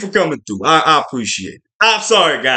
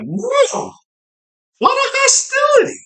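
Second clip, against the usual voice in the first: much higher, shouted whoa of disbelief; mock shocked yell. The vlogger shouts his stunned reaction to the fight. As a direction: gasp it out like you can't believe the violence.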